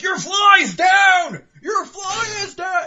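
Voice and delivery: very high, loud, slow yell; mock frantic shout; shouted warning. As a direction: bellow it across the street. Imagined guy shouting warning.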